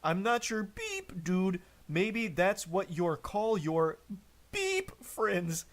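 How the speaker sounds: high voiced beeps inside a mock tantrum; self-censored rant. Reading boyfriend's censored texts aloud, mock beeping rant. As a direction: punch out each censor beep high and long. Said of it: "He's literally beeping himself with a sensor".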